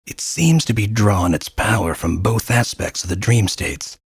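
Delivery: usual speaking voice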